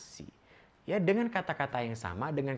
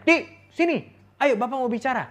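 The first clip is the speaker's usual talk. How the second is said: put-on loud, high-pitched yell to a child; demonstrated parental yelling at full volume; shouting; 13 semitones higher, 11 dB louder than usual. Shown as a demonstration. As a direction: shout for the child to come over. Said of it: "tidak usah teriak-teriak".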